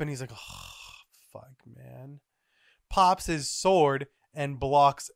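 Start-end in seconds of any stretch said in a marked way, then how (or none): 0.3-2.2 groggy grumble. Acted. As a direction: grumble it quietly, half asleep. Groggy figure woken from nap.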